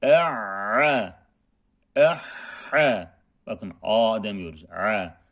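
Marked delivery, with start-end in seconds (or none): 0.0-3.2 "E'a" throat-squeezed, then "Eha" breathy h; ayn then ha. Shown as a demonstration. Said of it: "karşılaştırmalı okuyalım".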